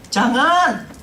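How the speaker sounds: loud, high shouted refusal; child's yell. Retold or imitated. Boy stopping the doctor, imitated loud yell. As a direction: cry out in protest like a child. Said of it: "dia teriak seperti itu".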